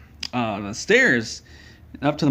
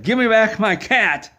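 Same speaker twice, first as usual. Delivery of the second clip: demanding shout; 9 semitones higher, 4 dB louder than usual. Retold or imitated. Dangling over pit, demanding cat.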